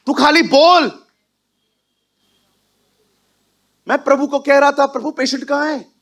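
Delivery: loud, high preaching voice; preacher rousing the congregation, full force; fiery preaching. Real, not acted. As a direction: preach it with fire. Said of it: "preaching at full force".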